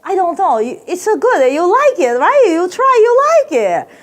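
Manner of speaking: high sing-song mock vendor accent; hammed-up vendor pushing his food, stretched at the end; Chinese street vendor. Vendor urges a tourist to taste, high mock accent. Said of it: "And the guy goes".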